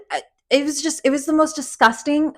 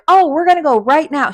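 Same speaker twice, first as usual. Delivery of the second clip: higher and louder eager excuse; higher, pushy fake hurry; mock urgent rescuer; 6 semitones higher, 4 dB louder than usual. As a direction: sell the escape plan brightly. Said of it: "And they're like".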